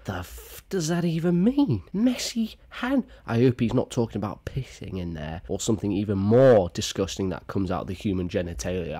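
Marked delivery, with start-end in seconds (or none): 0.0-3.0 shocked squeak, way higher; can't believe what he just read; incredulous disbelief. Real, not acted. Incredulous reaction to prank.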